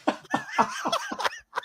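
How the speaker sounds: high, wheezy burst of laughing; helpless laughter. Real, not acted. Storyteller breaks up at his own bird story, helpless laughter. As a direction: crack up helplessly. Cracking up after the bird's demise.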